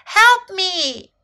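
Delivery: high, loud call for help; shouted plea. A narrator's reading. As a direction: cry out for rescue. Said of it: "shouted Little Elephant".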